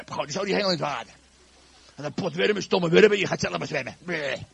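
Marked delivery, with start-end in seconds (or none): 1.9-4.4 hammed-up petulant griping; grumpy. Shown as a demonstration. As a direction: scold the bait in a sulk. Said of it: "hoe vis je nou zagrijnig?".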